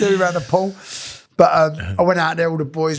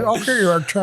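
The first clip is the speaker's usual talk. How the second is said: higher put-on voice; imitating a workmate; 7 semitones higher than usual. Shown as a demonstration. Demonstrating how the workmate answers about tea, imitated high voice. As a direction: show how the mate talks. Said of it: "this is how he talks".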